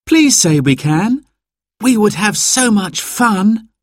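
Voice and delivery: put-on high, eager little boy pleading; a small boy asking hopefully; child voice. A narrator's reading. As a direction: beg like an excited child. Narrator voices child Simon.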